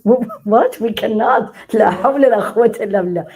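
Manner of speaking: loud, high exclamation of disbelief; mock-shocked disbelief. Real, not acted. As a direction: react aghast to what you just heard. Reacts to audience member's answer.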